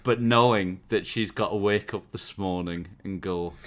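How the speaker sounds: usual speaking voice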